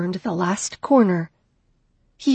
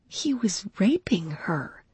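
horrified whisper; 6 dB quieter, slower than usual. A narrator's reading. Witnessing assault, horrified narration.

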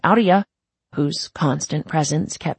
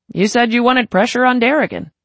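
careless gang boss; 6 semitones higher, 5 dB louder than usual. Acted. Kelly's voice, careless.

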